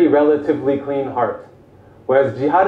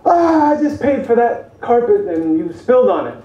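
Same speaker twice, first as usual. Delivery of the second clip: higher-pitched imitation of an angry father; retold exasperated outburst over a spill; mock yell; 7 semitones higher than usual. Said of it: "he comes in and he yells".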